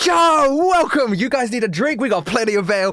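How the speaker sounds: high, loud party-host greeting; exuberant Blake. Acted. Blake welcomes his friends to a drink, exuberant. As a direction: whoop and holler a welcome to old friends. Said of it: "but immediately Blake goes".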